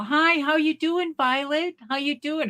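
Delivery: lightly acted high-pitched greeting; chirpy caller. Clinic staff calling an old woman to check in, chirpy.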